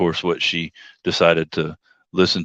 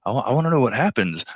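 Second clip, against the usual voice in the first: eager quote; 6 semitones higher, faster than usual. Retold or imitated. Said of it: "I was like".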